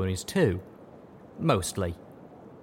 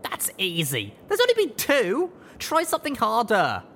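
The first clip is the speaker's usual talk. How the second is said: much higher, louder scornful filly; dismissive older sister; 13 semitones higher, 4 dB louder than usual. A narrator's reading. Rush brushing off her little brother, mocking. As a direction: brush him off, rising to a taunt. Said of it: "blowing her sibling off".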